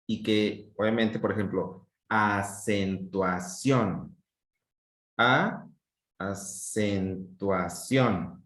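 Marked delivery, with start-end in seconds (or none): 2.1-4.2 "acentuación" very slowly, split into separate syllables. Shown as a demonstration. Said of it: "la forma en que vamos a separar una palabra". 5.2-8.4 "A, acentuación" slowly, pausing between syllables. Shown as a demonstration. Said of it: "la forma en que vamos a separar una palabra".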